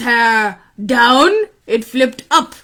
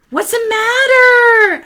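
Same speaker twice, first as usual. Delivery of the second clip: put-on high, drawn-out kid voice; concerned boy Aaron; 10 semitones higher, 4 dB louder than usual. Boy asking yelling man, high and drawn out. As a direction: call it out high and slow. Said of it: "asked Aaron".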